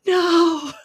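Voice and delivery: a high, pained cry of no; dismayed no. Retold or imitated. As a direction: wail it in mock despair at the guitar. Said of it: "and I'm like".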